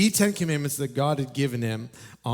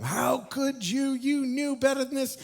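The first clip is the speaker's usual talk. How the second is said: high, pained scolding; mock scolding a child, pitched up high; a disappointed parent's high reproach; 11 semitones higher than usual. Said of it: "in your righteous anger, you just start giving it to them".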